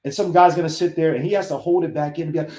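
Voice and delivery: ordinary talk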